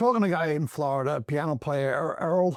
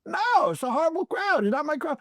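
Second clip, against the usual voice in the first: high protesting reply; 9 semitones higher than usual. Retold or imitated. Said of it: "I went".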